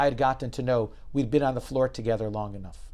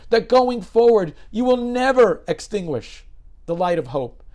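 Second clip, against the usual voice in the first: louder and higher, driving the point home; rally him to change; passionate exhortation; 10 semitones higher, 10 dB louder than usual. Retold or imitated. David exhorts the doctor passionately.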